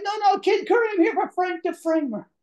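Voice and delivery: high, loud frantic pleading; desperate explanation. Retold or imitated. Fallen man tells armed guards he is here for Frank, high frantic. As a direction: blurt it out before they shoot.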